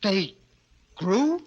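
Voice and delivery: astonished. Acted. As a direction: gasp it out in wonder. Astonished at the news.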